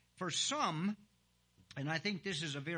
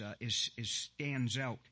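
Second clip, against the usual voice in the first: normal talking voice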